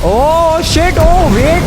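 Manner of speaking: loud, high-pitched alarm; loud, startled exclamation; startled shout. Real, not acted. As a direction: cry out in sudden shock. Powers suddenly go off in game.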